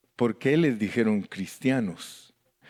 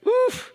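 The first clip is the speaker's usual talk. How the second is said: very high "oof" whoop; delighted whoop; 4 dB louder than usual. Real, not acted. Preacher delights in the name, high whoop. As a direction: let out a high, short whoop.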